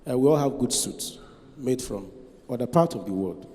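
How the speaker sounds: ordinary delivery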